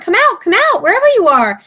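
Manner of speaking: high, hammed-up wolf call; mock wolf roar. Pigs retelling the wolf's call, mock roar. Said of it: "he roared".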